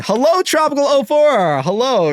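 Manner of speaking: high, loud, drawn-out hello; excited greeting. Real, not acted. Host greeting a viewer who just joined, excited. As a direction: sing out a big hello.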